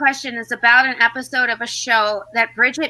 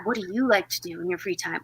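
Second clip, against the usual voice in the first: low, soft repeat; 5 semitones lower, 8 dB quieter, faster than usual. Retold or imitated. Quoting the parody's answerer, low and quiet. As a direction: question said lower and softer. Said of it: "she'd be like".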